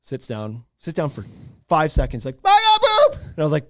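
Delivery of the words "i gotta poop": put-on high whiny kid voice, very high; little kid voice. Dad imitating his toddler son's urgent need. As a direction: squeak it like a desperate toddler.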